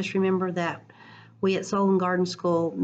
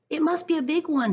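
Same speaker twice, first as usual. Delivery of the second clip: excited Papa; 10 semitones higher than usual. Acted. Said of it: "he said".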